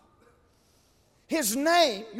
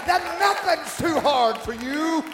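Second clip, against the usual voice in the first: loud preacher's plea, climbing higher as it builds; impassioned preaching cry; 5 semitones higher, 8 dB louder than usual. Acted. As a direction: cry out to God at full pulpit force.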